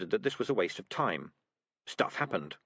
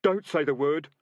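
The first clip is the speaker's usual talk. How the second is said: louder, pleading urgency; frightened highwayman begging, louder; more urgent; 6 semitones higher, 6 dB louder than usual. A narrator's reading. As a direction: beg him not to. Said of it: "even more urgently".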